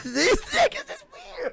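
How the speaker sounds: high, slow, through laughter; laughing. Real, not acted. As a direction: say it while cracking up. Laughing repeat of the line.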